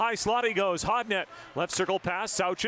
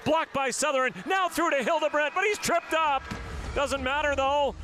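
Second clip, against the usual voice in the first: excited shout; 5 semitones higher than usual. Real, not acted. Play-by-play caller on a shorthanded two-on-one, shouting. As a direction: yell out the scramble as it happens.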